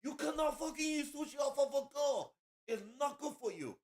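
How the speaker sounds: high-pitched put-on manager voice; mocking the manager. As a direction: squeal it like a frantic restaurant manager. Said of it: "the manager's coming up like".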